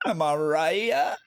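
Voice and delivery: milk the "Am I right?" for the chat; drawn-out teasing "right" sliding up; smug repeat. Shown as a demonstration. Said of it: "Takes notes".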